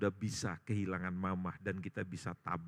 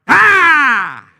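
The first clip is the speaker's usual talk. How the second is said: very loud, high drawn-out "aah"; explosive scream that falls away; sudden startled yell; 26 dB louder than usual. Acted. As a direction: shock them with a huge cry. Supposedly dead mother suddenly cries out.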